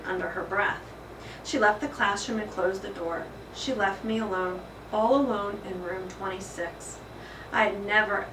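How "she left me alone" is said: plain reading voice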